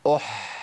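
أح with a long breathy ح; isolated ha. Shown as a demonstration. Teacher drilling the letter for students to repeat. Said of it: "هقولها تاني ... قولوا ورايا".

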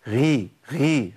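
غي drawn out slowly, distorted; wrong ghayn. Shown as a demonstration. Teacher shows the weak kasra ghayn mistake. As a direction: stretch غي into a breathy kha. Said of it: "غي، ما ينفعش".

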